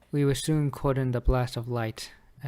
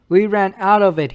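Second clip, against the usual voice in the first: raised, louder mimicry of his argument; insistent quote; 5 semitones higher, 5 dB louder than usual. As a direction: quote him with heated insistence. Retelling Drake's argument against him.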